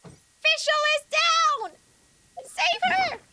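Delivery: high, loud panicked squeal; Paimon voice. Pretend. Streamer as Paimon when a teammate falls, high loud panic. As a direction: shriek for help as the little companion. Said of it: "That is your Paimon for the day".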